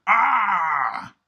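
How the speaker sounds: loud high panicked ah; scream of alarm. Retold or imitated. As the crane speeds up, a frightened cry. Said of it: "then I go".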